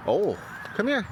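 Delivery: bright high call to the dog; high-pitched dog call. Real, not acted. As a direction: call her over up high. Calling the dog over.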